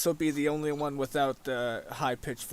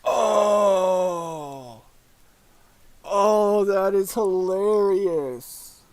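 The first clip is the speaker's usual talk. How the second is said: delighted; 5 semitones higher, 7 dB louder, much slower than usual. Real, not acted. Delighted reaction to silencer role.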